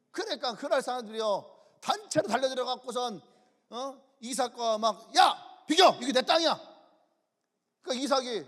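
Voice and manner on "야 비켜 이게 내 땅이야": loud, high order to move; mock angry outburst; angry confrontation. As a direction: shove a rival off your land with your voice. Acting out a land quarrel.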